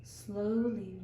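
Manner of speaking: ordinary delivery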